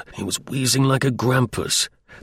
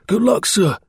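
called out; 11 semitones higher than usual. Acted. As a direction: call after him as you limp past. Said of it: "waved his hand as he hobbled by and cried".

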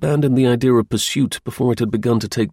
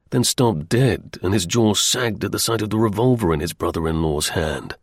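ordinary delivery, nothing marked

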